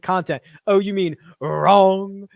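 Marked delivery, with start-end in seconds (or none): 1.4-2.3 a slow, drawn-out imitation of Mark's accent on wrong; imitating Mark's accent. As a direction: say wrong slowly, copying Mark's odd accent. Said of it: "Mark's wrong accent".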